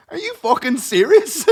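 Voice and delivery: loud, high-pitched disbelief; retold incredulity. Replying to Margaret's question, loud hammed-up incredulity.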